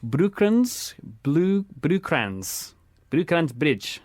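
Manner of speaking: slow, careful South African accent; drawn-out attempt at a South African accent. Shown as a demonstration. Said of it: "I'm gonna try in a South African accent".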